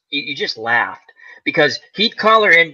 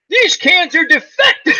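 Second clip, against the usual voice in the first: laughing quote; 12 semitones higher than usual. Retold or imitated. Quoting sniper line, laughing.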